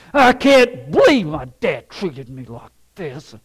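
loud, hammed-up grumble; imitating a grumbling son; acted grumbling. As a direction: grumble resentfully about dad. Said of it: "he grumbled the whole time".

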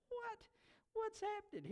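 high, incredulous questioning; acted bewilderment. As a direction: voice jumps up in disbelief. Disciples' shock at Jesus on water.

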